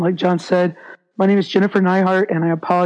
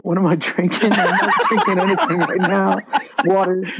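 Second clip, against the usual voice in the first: laughing. Real, not acted. Laughs at drinking question.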